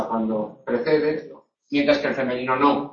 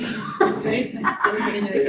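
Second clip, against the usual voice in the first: breathy laugh; 6 semitones higher, 4 dB quieter than usual. Real, not acted. Laughs at own example.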